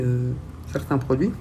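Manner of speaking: ordinary delivery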